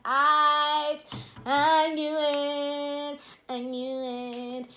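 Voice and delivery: loud, slow told-you-so; slow triumphant "I knew it". Retold or imitated. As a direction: draw it out loud, gloating. Triumphant realization.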